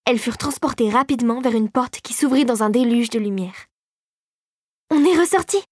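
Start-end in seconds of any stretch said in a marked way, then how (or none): 4.9-5.6 high-pitched burst of surprise; astonished exclamation. A narrator's reading. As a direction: exclaim in wonder, voice jumping up. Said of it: "s'exclama Clara".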